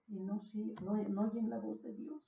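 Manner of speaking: ordinary delivery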